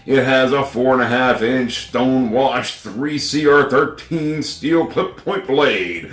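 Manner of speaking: loud, slow ad read; commercial voice. Acted. Rereading the knife specs as a commercial announcer. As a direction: announce each spec like a TV spot. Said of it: "when I read it like that".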